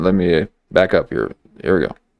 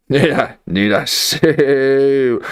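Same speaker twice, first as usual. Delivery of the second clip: slow, higher, with a long stretched SAY; drawn-out repeat; 4 semitones higher, much slower than usual. Real, not acted. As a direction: drag out "say" for emphasis. Same question repeated, second stretched.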